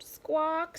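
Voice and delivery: high squawk; chicken squawk. A narrator's reading. Chicken answers with a high squawk. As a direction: squawk like a hen. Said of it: "said Chicken".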